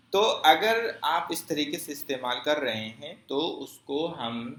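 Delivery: ordinary talk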